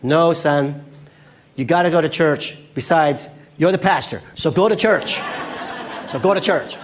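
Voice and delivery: loud, emphatic mom ordering her son; hammed-up motherly command; insistent mother. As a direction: lay down the law to a whining son. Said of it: "the son who wakes up".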